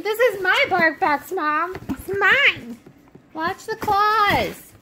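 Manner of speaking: high, loud, playful dog voice; voicing the dog. Owner voicing her dog guarding the box, high and bratty. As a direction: speak as the greedy dog claiming his toy box. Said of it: "He's like".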